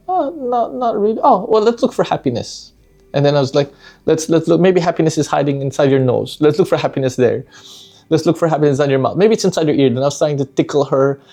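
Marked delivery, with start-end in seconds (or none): 0.1-1.2 put-on high child voice. Daughter's voice, retold. 1.2-9.9 playful. Retold or imitated. Playful dad hunting happiness.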